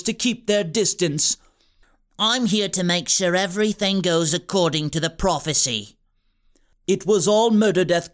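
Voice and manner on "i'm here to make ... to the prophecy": scheming villain. A narrator's reading. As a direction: purr the threat down at them. Wilfred explains plan.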